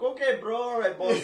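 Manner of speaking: put-on Armenian-American accent; Americanized Armenian accent. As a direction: greet like an Americanized Armenian, big and loud. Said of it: "the Americanized Armenians are like".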